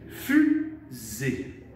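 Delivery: « Fusée » in two drawn-out beats; split into syllables. Shown as a demonstration. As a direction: say « Fusée » as fu, pause, zée. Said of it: "Il y a deux syllabes". (« Fusée » découpé en deux syllabes)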